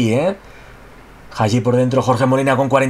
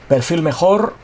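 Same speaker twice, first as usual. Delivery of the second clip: ordinary delivery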